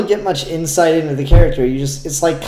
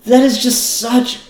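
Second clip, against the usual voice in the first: delighted; 4 semitones higher, 4 dB louder, slower than usual. Real, not acted. Delighted reaction to the comic.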